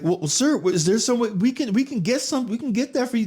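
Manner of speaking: high-pitched imitation of a flustered server. Server scrambling to keep a walking-out customer, flustered and high. Restaurant staff pleading with departing customer.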